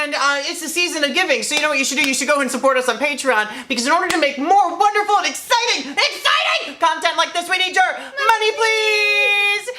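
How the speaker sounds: high, hammed-up pleading plea; mock begging pitch. Mock pleading for Patreon money.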